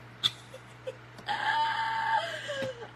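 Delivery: high shrieking laugh that breaks into a squeal; burst of laughter. Real, not acted. Realizing she got the day wrong, shrieking laugh. Cracks up at her own mistake.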